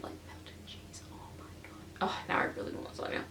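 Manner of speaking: hushed, craving whisper about cheese; soft whisper of longing; dreamy whisper. Real, not acted. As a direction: breathe it out like you can taste it. Craving lasagna, drifting to whisper.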